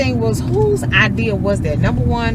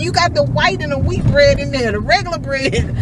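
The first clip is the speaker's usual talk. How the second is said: excited explaining; 10 semitones higher than usual. Retold or imitated. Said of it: "I said".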